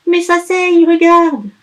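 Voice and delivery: put-on high, loud childish crying; wailing elf. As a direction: wail to be let in, whining. Elf insists.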